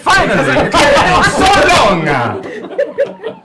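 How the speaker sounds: hammed-up Italian accent; over-the-top Italian accent. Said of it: "he does this".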